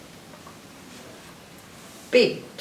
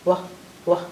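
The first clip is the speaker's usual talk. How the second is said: ordinary talk, nothing marked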